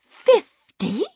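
high, incredulous question; astonished. A narrator's reading. Mother hearing how much Waif ate, astonished. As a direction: echo it in disbelief.

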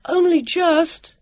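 high, drawn-out call; slow, high answering shout; calling back. A narrator's reading. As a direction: stretch the words to carry back. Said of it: "Sam called back".

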